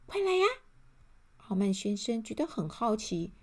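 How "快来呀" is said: high, quick elf call; little elf. A narrator's reading. Elf turns back to call Mr. Arrogant along, high elf voice. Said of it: "然后回过头来说".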